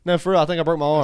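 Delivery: loud, high re-enacted insistence; insistent calling. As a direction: plead the point loudly through a door. Acted child insisting through door.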